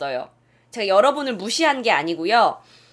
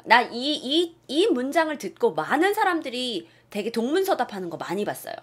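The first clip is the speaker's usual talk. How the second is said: ordinary talk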